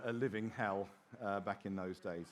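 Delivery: ordinary talk, nothing marked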